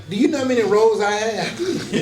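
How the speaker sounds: laughing retort. Retold or imitated. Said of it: "I go back".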